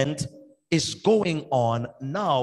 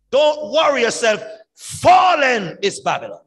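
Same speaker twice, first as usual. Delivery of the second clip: higher and louder, ringing reassurance; triumphant proclamation; 5 semitones higher, 5 dB louder than usual. Acted. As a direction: proclaim victory over the oppressor. Preacher proclaiming comforting message.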